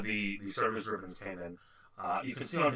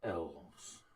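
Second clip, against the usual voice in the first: muttered disdain after a breath; 7 semitones lower, 11 dB quieter than usual. Acted. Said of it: "He kinda takes a breath".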